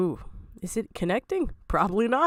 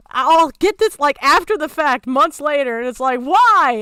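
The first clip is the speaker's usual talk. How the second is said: loud, higher frustrated complaint; exasperated rant; 3 semitones higher, 7 dB louder than usual. Real, not acted. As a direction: vent like you've had enough. Ranting about statements.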